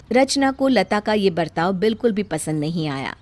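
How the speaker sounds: plain reading voice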